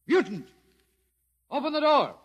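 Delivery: high, loud order through a door; shouted demand. Acted. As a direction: demand entry at full voice. Officer demands door be opened.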